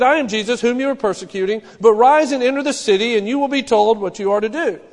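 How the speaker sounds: booming quoted command; voice of Jesus. Acted. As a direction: proclaim it like a voice from heaven. Jesus speaks to Saul, quoted.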